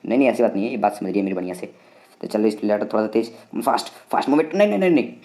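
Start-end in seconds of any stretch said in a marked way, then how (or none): 4.6-5.1 rising high-pitched no no no; scared of crashing; panicked. Real, not acted. Narrowly avoids crashing. (घबराकर ऊँची आवाज़ में "नहीं नहीं")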